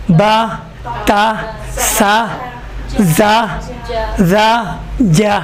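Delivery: با، تا، سا with open unrounded "aa"; spaced drill of light syllables through جا; thin, light. Shown as a demonstration. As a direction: keep each alif thin, lips unrounded. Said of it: "اس طرح سے".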